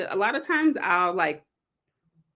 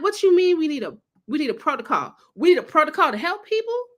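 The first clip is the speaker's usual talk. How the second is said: irritated; 8 semitones higher than usual. Retold or imitated. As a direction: fire it back, outraged at the idea. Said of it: "I was so irritated".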